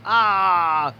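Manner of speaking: loud, high scream of agony; pained scream. Acted. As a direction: cry out as the dogs bite. Victim mauled by dogs. (दर्द भरी चीख)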